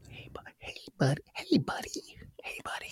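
mock ASMR porn whisper in a hillbilly voice.

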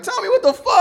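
very high, loud mock cry; high, loud mock outrage at Tommy; mock yell at Tommy. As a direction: shriek it up high like an outraged fan. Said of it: "You was in that bitch like".

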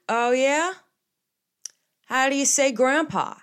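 put-on loud, high, testing old relative; imitated challenging grandpa. Grandpa testing granddaughter's Italian, loud challenge. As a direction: quiz her loudly as the grandpa. Voicing grandpa quizzing her on phone.

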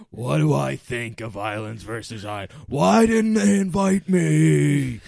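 slow, loud, drawn-out Rupert voice with a whine; Rupert impression. Joking about Rupert's speech, a loud whiny Rupert voice. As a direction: ham up a whiny castaway's complaint. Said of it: "good Rupert impression".

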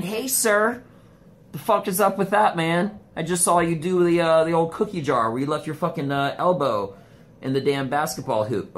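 loud, high, heated call-out; yelling at him across the gym; mock angry yell. To the dunking guy in the handicap spot, an indignant yell.

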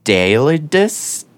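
Daedalus drawn out slowly, syllable by syllable; carefully pronounced. Shown as a demonstration. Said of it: "Nailed it".

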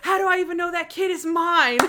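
high-pitched indignant comeback; acted-out disbelieving protest; mock indignation. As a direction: imitate a kid arguing back, voice pushed up. Retold kid disputing paternity in quarrel.

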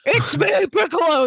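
high-pitched mock phone call; Piccolo. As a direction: announce yourself high and playfully. Voicing Piccolo on mind phone.

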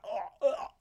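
mock chugging sounds; imitated gulping. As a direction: mime downing a pint with your throat. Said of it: "holding the bottom of the glass".

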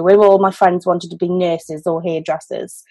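ordinary talk, nothing marked

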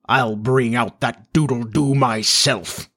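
slow, deliberate vow; menacing resolve. A narrator's reading. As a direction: declare it grimly, drawing it out. Captain vows with menacing gesture.

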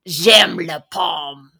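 slow, heavy fake French accent; mimicking a French language CD in a heavy accent. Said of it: "this really heavy, terrible accent".

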